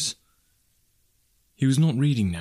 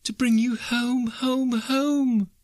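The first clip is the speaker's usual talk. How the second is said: put-on high, singsong child voice; high-pitched, overjoyed little sister; gleeful little girl; much slower than usual. A narrator's reading. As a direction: chant it delightedly like a small child. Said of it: "bending down to laugh".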